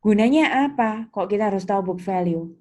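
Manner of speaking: ordinary talk, nothing marked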